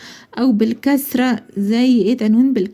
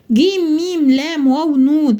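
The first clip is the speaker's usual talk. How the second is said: letter names read out one by one; spelling out letters; 3 semitones higher than usual. Shown as a demonstration. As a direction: spell it letter by letter in an even chant. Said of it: "هل الكلمة دي صحيحة؟". (تهجية الحروف حرف حرف)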